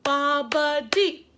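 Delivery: "Bobbity" very slow, syllables apart, rising; slow dictation. Shown as a demonstration. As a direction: repeat "Bobbity" slowly for spelling. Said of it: "One more time".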